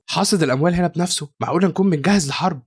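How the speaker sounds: ordinary delivery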